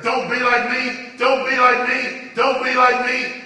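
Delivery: loud slow repeated cry. Retold or imitated. Inmate crying out to visitors, loud slow warning. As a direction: shout the warning, slow and pleading. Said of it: "he's raising his voice".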